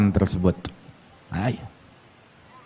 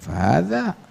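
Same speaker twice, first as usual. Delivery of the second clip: ordinary delivery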